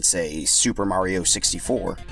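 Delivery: plain reading voice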